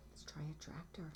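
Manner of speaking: hushed whispered thought. Acted. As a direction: whisper it to yourself. Duck's thoughts, quietly.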